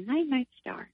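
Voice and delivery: put-on a small child saying goodnight from the back seat; little child's voice. Mother imitating her son in the dark car, soft and high. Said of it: "we just heard his little voice say".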